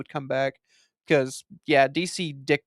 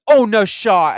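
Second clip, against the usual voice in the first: much louder and higher, stunned disbelief; shocked exclamation; 5 semitones higher, 10 dB louder, slower than usual. Retold or imitated. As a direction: blurt it out in shock. Retells shock at the cameo.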